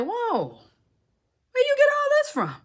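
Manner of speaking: high, drawn-out amazed exclamation; astonished. Retold or imitated. Naomi to Ruth about what she brought home, astonished. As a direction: voice shooting up in playful amazement.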